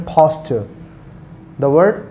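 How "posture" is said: "posture" as PHOS-chuh, breathy puff on p; aspirated P. Shown as a demonstration. Teacher modelling aspirated P in "posture", said PHOS-chuh. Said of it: "फा का sound इस्तेमाल किया है".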